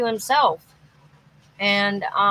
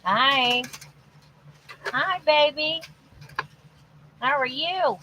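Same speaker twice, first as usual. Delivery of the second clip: higher, sing-song hello to a little one; 5 semitones higher than usual. Real, not acted. Greeting the baby on the video call, higher cooing voice.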